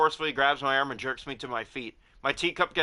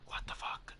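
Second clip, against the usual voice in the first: stunned whispered curse; horrified hushed what the fuck; shocked whisper; 15 dB quieter, slower than usual. Real, not acted. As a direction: breathe it out in disbelief. Shocked by game scene.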